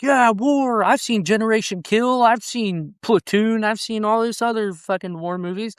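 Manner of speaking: high, eager voice of a clueless wannabe soldier; mock naive recruit. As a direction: ham up a kid who thinks war is a movie. Mocking naive new recruits.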